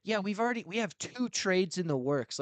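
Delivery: high, mocking voice of a careless team owner; mocking Jerry Jones. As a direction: parrot the owner in a mock high voice. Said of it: "He's like".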